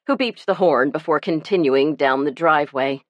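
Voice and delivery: usual speaking voice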